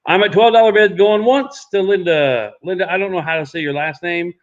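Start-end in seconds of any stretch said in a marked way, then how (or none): 0.0-2.5 auctioneer calling going once. Acted. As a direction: announce the lot going once.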